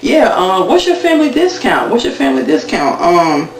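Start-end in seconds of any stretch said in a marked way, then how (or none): 0.0-1.9 much higher, teasing question; imitating a sly bargain-hunter, voice up high; cheeky ask. Cast member asking cheekily.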